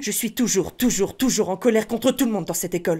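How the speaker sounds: thinner and lighter, a first step toward Harry. Shown as a demonstration. Teaching step two, adding a thin light voice. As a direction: make the voice slight and fluty. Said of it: "vous rajoutez la voix fluette et aiguë".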